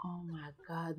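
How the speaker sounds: ordinary talk, nothing marked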